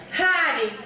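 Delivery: [name] drawled in a broad Southern accent; Southern howdy. Shown as a demonstration. Teaching the audience a Southern greeting, Southern drawl. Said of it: "this is a greeting".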